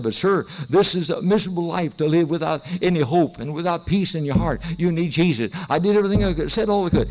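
loud, pressing appeal from the pulpit; forceful preaching plea. Retold or imitated. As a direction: plead with rising force, driving each phrase. Preaches his plea at full force.

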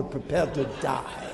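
lightly voiced giant in a mock accent; Philistine giant. Giant threatens his father's killer, mock-accent menace. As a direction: drop back from the bellow for the threat. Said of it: "This is a Philistia accent here".

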